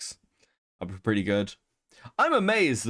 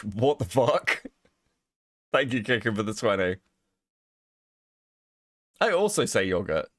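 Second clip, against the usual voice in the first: ordinary delivery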